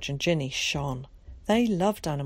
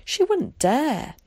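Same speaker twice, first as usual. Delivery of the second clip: high, slow, louder gasp of disbelief; 10 semitones higher, 6 dB louder, slower than usual. A narrator's reading. Ginny reacts to Carrie's bold plan, gasping slowly. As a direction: stretch out the shock. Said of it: "gasped Ginny".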